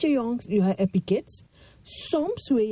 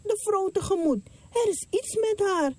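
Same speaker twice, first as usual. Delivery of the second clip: urgent prophet; 10 semitones higher than usual. Acted. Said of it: "Zij zei tegen zijn knecht".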